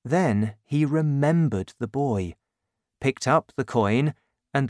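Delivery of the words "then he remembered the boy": plain reading voice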